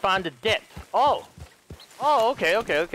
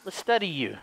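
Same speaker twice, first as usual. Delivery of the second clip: ordinary talk, nothing marked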